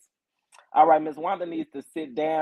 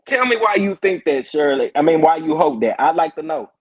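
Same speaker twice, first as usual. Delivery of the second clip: loud teasing demand; 7 dB louder than usual. Real, not acted. Challenging a chatter.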